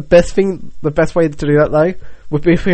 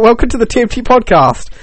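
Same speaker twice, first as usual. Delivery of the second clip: announcer voice; 4 semitones higher than usual. Pretend. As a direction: welcome the listeners grandly. Mock podcast host intro.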